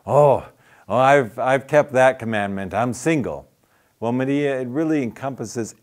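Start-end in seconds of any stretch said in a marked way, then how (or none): 0.0-3.5 louder, lightly excited retelling; proud relief, mildly bubbly; happy. Retold or imitated. Single woman proud of keeping commandment, mock happy. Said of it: "she gets all happy and says".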